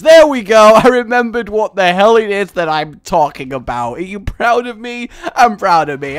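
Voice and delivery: loud, triumphant cheer; excited. Real, not acted. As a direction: celebrate the small win. Remembers word, cheers himself.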